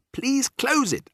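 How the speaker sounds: very high, insistent cry; frantic small-voiced appeal; urgent plea. A narrator's reading. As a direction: beg desperately at high pitch. Mouse begs grandmother to shut door.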